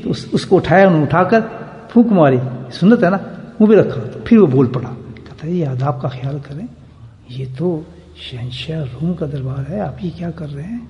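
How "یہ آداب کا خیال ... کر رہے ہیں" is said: much softer, confidential murmur of reproach; speaking softly. Retold or imitated. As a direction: discreetly caution him in an undertone. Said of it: "اس نے تو آستہ کہا".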